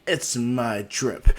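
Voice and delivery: slow, drawn-out mock brag; joking boast. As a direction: build it up grandly, then stretch the punchline. Naruto jokingly boasts to Sasuke.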